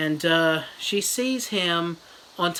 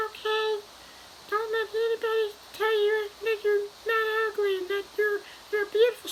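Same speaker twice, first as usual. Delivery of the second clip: very high squeaky mom voice; falsetto mom. Retold or imitated. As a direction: squeak like a cartoon mom cheering her daughter. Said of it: "She's like".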